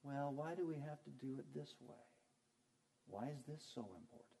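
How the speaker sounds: quiet, insinuating complaint; understated grumbling. Acted. Preacher mimicking false teachers creeping in among you, soft understated grumble. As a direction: stir things up softly with doubting questions. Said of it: "Just listen to 'em".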